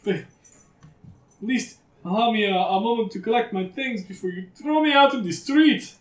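high, loud, pleading voice; desperate begging. Acted. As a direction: beg for a little mercy. Dealer begs for a moment.

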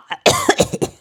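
short breathy cough; dry cough. Acted. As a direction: cough like a sick old man. Sick king coughs.

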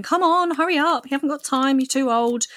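high, pushy nagging voice; impatient inner meanie tapping her watch; nagging inner critic. Retold or imitated. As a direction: nag yourself to hurry up. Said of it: "my inner meanie is, like, tapping her watch".

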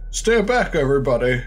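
high, loud warning cry; shouting warning. Acted. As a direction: yell it to hold them back. Character threatens with Molotov.

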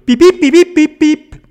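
very high, loud beeps; piercing imitated robot bleeps; robot beeping. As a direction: beep rapidly like a toy robot. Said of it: "da ruft der Roboter".

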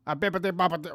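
high, loud, hammed-up Italian; mock Italian accent. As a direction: bounce it like a cartoon Italian. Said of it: "What do Holland people sound like".

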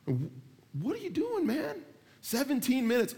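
very high-pitched incredulous complaint; exasperated disbelief. Acted. Driver reacting to seventeen-minute radio prayer, incredulous. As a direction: voice shoots up in mock protest.

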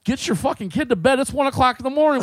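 mock outrage, rising in volume; angry scolding, retold. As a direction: imitate an irate man scolding a parent. Retold quarrel, man scolds mother.

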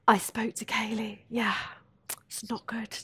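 hushed, breathy gossip voice; gossipy whisper. Retold or imitated. Mimicking people whispering gossip about a grieving friend. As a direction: whisper it like passing on bad news. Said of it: "she'd be like".

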